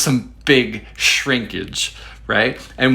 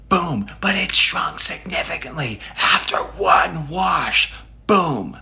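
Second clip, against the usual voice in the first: high, strained mock-explosive delivery; dramatic outburst; 8 semitones higher than usual. Replaying a shrinkage complaint as drama, high and explosive. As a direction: blow it up theatrically, pitched way up.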